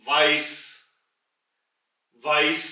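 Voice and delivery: वाइस with a v sound; German word demo. Shown as a demonstration. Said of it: "वे". (वाइस का German उच्चारण)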